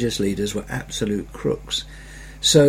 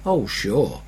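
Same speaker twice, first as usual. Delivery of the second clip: sarcastic; 3 semitones lower, 3 dB quieter than usual. Retold or imitated. Sarcastic reply to Jesus.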